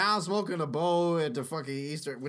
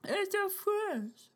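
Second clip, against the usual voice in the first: softer falsetto zombie taunt; creepy zombie coo; 13 semitones higher, 5 dB quieter than usual. Acted. Zombie teasing.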